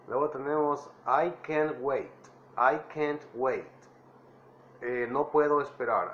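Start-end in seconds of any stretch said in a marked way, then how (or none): 1.1-2.1 "I can't wait" said slowly, stressing "I"; drawn out for learners to copy; slow teacher demo of "I can't wait"; slow model phrase. Shown as a demonstration. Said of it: "traten de imitar mi voz". 2.6-3.7 "I can't wait" said slowly, stressing "I"; drawn out for learners to copy; slow teacher demo of "I can't wait"; slow model phrase. Shown as a demonstration. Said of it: "traten de imitar mi voz".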